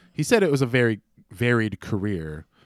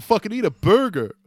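higher and louder, punching fucking eat a burger; emphatic outburst; 10 semitones higher, 6 dB louder than usual. Real, not acted. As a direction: blurt it out like a fed-up rant.

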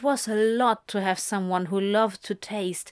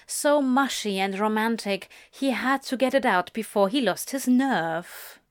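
plain reading voice

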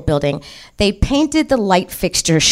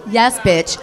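normal talking voice

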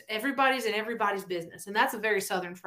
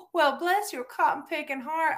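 polite Southern insult; 6 semitones higher than usual. Acted. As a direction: bless them sweetly while meaning an insult. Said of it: "that's how we say it".

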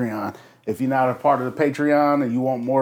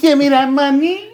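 excited; 13 semitones higher, 3 dB louder than usual. Real, not acted. High excited demand.

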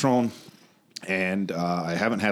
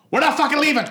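high, loud triumphant declaration; over-the-top defiant yell; mock defiant shout; 6 dB louder, faster than usual. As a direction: shout it out like a hammed-up rallying cry. Acted defiant league announcement.

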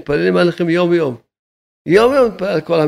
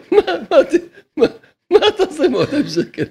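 high mocking voice; 10 semitones higher than usual. Rabbi scoffing at those arguing over two hundred shekels, high and mocking. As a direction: jump way up into a high, incredulous mocking pitch.

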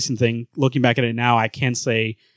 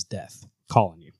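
mock ominous phone voice; 6 semitones lower, 5 dB quieter than usual. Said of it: "pops up and says".

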